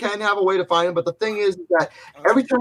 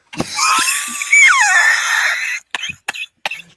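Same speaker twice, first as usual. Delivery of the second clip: shrieking laugh. Real, not acted. Cracking up at Gargoyles.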